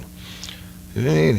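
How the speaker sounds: a lightly voiced boss, drawn-out and baffled; surprised boss. Boss asking the hunter why no birds, slow surprised question.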